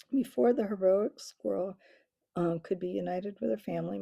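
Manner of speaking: ordinary delivery, nothing marked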